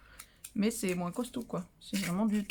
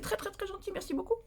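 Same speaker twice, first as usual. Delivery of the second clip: high sweet thanks; 9 semitones higher, 7 dB quieter than usual. Real, not acted.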